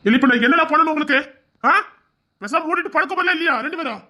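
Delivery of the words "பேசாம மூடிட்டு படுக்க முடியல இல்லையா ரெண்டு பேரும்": exasperated scolding. Acted. Husband exasperated at both.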